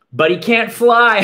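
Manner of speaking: high, loud teasing laugh; mocking laugh. Cousin Bug laughing at Caramba for not flying, jeering. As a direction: laugh at him, taunting. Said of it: "laughed Bug".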